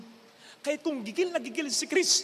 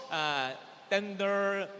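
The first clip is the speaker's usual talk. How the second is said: ordinary talk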